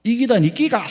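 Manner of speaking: loud blunt Gyeongsang snap; Gyeongsang dialect retort. Retold or imitated. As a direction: bark it out in thick Gyeongsang dialect. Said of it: "경상도 사람이 뭐라 그랬다고요?".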